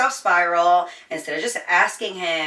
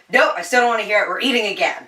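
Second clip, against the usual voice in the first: fast and louder, bossing her friend around; bossy best friend; 4 semitones higher, 6 dB louder, faster than usual. Retold or imitated. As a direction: brush off her excuses, cheerfully bossy.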